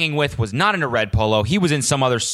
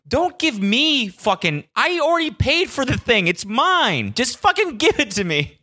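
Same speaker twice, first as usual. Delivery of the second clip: much higher, exasperated comic rant; mock outrage; 9 semitones higher than usual. Customer ranting at the employee about his phone, higher and exasperated. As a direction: blow up at her in pitched-up disbelief.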